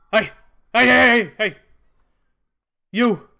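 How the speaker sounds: put-on loud, fast old hobgoblin calling out; gravelly sly hobgoblin. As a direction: hail her down the alley as an old goblin. Hobgoblin calls out to her.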